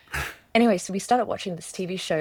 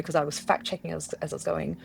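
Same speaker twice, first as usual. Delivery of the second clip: ordinary talk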